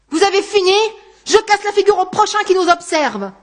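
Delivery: loud, high explosive threat; angry shouting. A narrator's reading. Anguste exploding at the onlookers, shouting angrily. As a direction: explode at the gawkers. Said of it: "se mit à crier à l'adresse des spectateurs".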